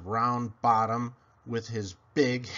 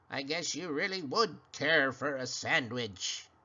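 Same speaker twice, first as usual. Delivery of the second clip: cackling creature; 3 dB quieter than usual. Acted. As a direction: croak like a little witch. Creature voice continues.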